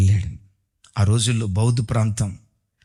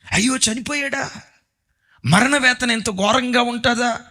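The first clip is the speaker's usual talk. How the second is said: high, pained cry of shock; a preacher voicing a man's horror at a death; shocked dismay; 10 semitones higher than usual. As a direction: gasp it out in dismay.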